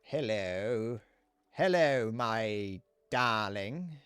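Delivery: stretched-out romantic drama voice; mock Shakespearean. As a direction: ham up a courtly lover's greeting. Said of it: "a bit more Shakespearean".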